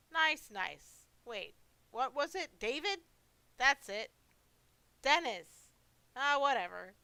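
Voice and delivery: lightly voiced old man fumbling for a name; forgetful old monster. Monster trying to recall the visitor's name, forgetful old monster.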